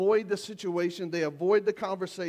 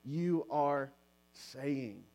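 slow, soft and sincere; caring tone; 4 semitones lower, 4 dB quieter, slower than usual. Shown as a demonstration. Pastor models for couples how a caring voice sounds, slow and soft. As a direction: let your voice say you care. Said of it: "all needs to say".

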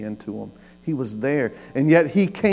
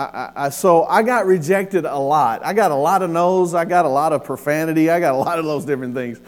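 usual speaking voice

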